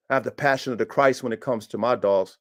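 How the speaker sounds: usual speaking voice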